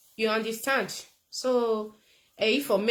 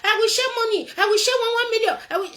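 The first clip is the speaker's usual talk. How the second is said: high, loud mock campaign yell; mocking Sowore shouting; 12 semitones higher, 8 dB louder, faster than usual. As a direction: ham up a politician promising cash. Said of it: "Sowore was shouting".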